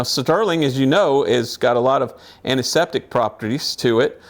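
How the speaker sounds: ordinary delivery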